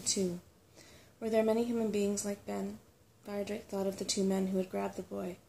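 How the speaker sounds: ordinary delivery, nothing marked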